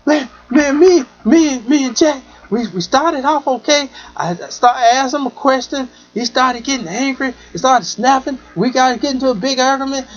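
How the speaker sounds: high, urgent, rushed venting; upset woman retold. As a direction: tell it like a shaken woman after a fight. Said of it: "just crying and going off".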